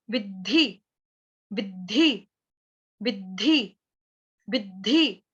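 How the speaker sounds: विद्धि with a hard hit on the half द before ध; slow pronunciation drill. Shown as a demonstration. Teacher showing students where the stress falls in विद्धि. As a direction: land the आघात on द्ध in विद्धि. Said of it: "दरवाजे वाले द का आघात लगेगा".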